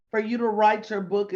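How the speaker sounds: ordinary talk, nothing marked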